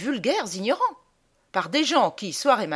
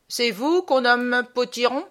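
high, loud, grouchy officer; peevish military superior; grumpy colonel; 4 semitones higher, 4 dB louder than usual. Acted. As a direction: question the recruit like a crusty colonel. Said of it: "Il me reçoit d'un air grognon".

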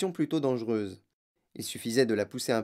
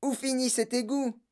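much higher, put-on woman's voice; alarmed cry; 11 semitones higher than usual. A narrator's reading. Madame Salt asks Wonka where the drain ends, alarmed cry. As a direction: cry it high without extra volume. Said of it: "cria madame Salt".

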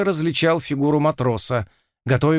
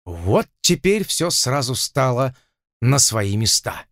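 plain reading voice

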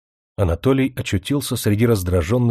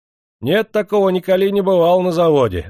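raised, higher crowd shout; angry shout from the crowd; 7 semitones higher, 3 dB louder than usual. A narrator's reading. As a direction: call it out high from the crowd. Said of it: "закричали в толпе".

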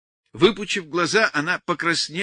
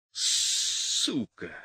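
spat curse; 3 semitones lower, 3 dB quieter than usual. A narrator's reading. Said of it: "смачно выплюнул".

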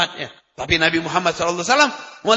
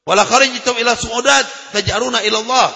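raised, measured Arabic recitation of a hadith; 5 semitones higher, 7 dB louder than usual. Acted. Preacher recites the hadith to the congregation, solemn and measured.

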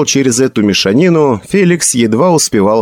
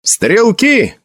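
high, brief call to the troops; shouted command; 11 semitones higher than usual. A narrator's reading. Felix calls his musketeers, shouting. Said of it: "крикнул Феликс".

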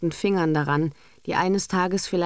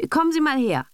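higher, a bit louder summons; summoning call; 10 semitones higher, 4 dB louder, faster than usual. A narrator's reading. Mother ordering the governess to come over. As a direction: order her in. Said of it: "rief Madame Kampf".

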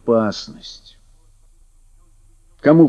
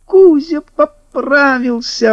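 high, slow gleeful cry; overjoyed; 14 semitones higher, slower than usual. Acted. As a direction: burst out with joy at the news. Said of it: "обрадовался Лешик".

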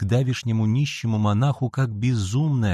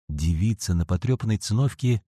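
usual speaking voice

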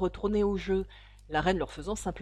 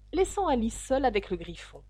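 usual speaking voice

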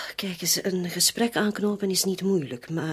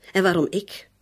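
louder, pushing back hard; raised indignant protest; heated protest; 7 dB louder than usual. Acted. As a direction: argue him down with force.

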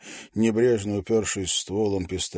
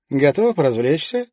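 put-on bubbly sorority girl; 7 semitones higher, 8 dB louder than usual. A narrator's reading. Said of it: "спросила Джули".

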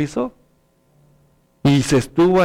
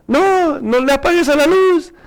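high-pitched imitation of a child; pleading child; 10 semitones higher than usual. Child begging parents to keep the light on, pleading. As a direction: plead like a child scared of darkness.

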